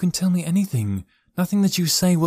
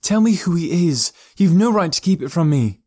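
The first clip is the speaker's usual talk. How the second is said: high, raised, indignant voice; angry demand; 8 semitones higher, 5 dB louder than usual. Acted. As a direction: insist on the truth, pitched up. Niece demands the truth.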